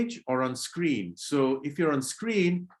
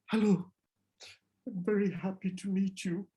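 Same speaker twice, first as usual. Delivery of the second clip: nervous, higher greeting that goes soft; worried; 7 semitones higher, 3 dB quieter than usual. Shown as a demonstration. Teacher showing students a worried greeting. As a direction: say it like you're scared of them. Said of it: "I'm worried. Maybe I'm scared".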